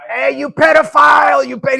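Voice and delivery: imitating a heckler yelling across a plant; screamed heckle. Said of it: "someone started screaming out".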